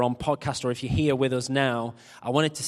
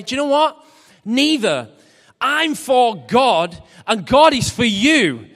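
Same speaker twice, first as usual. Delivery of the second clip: emphatic preaching; 9 semitones higher, 10 dB louder than usual. Acted.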